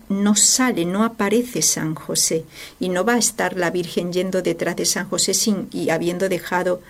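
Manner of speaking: ordinary talk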